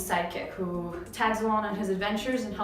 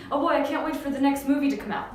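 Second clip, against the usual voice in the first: quick, higher, louder eager anticipation; excited; 4 semitones higher, 5 dB louder, much faster than usual. Shown as a demonstration. Teacher looking forward to the next movie, excited. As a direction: rush it out with excitement. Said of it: "when you're excited about something".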